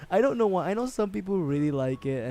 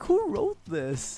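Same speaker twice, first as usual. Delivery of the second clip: slow, high disbelief; drawn out, baffled and high; incredulous; 13 semitones higher, much slower than usual. Retold or imitated. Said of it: "I was like".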